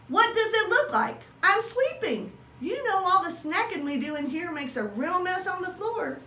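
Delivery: a hint of a busy wife explaining; high wife voice.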